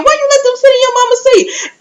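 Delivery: very high-pitched, exclaimed challenge; incredulous outburst. Real, not acted. Viewer calling out Jazz over her mama's seat, high incredulous outburst. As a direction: blurt it out high, in amused disbelief. Outraged repeat of her question.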